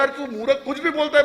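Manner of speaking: loud, high-pitched indignant outburst; angry scolding. Acted. Oilman berating the third friend, angry and loud. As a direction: explode at him in an acted rant.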